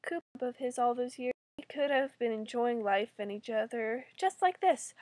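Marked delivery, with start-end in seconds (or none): none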